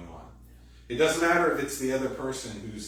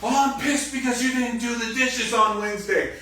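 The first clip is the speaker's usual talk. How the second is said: high, louder whiny complaint; mock grievance; 12 semitones higher, 8 dB louder than usual. Partner finally airing the dishes grievance, whiny outburst. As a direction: finally blurt out the petty grudge. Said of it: "finally it comes out".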